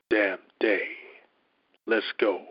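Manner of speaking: ordinary talk, nothing marked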